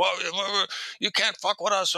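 high-pitched imitation of a broken-English caller; broken-English cursing. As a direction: sputter a threat in mock broken English. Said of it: "cursing at me in half-broken English".

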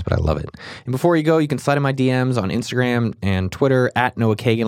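ordinary talk

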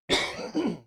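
sudden harsh cough; coughing fit. Real, not acted. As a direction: cough hard mid-sentence. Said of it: "sorry, man, coughing".

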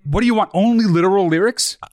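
ordinary delivery

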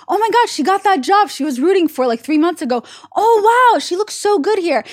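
high-pitched, gushing fan; gushing excitement. Retold or imitated. Rooting for the ex's new girlfriend, gushing. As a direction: cheer her on, voice lifted high. Acting out herself rooting for her.

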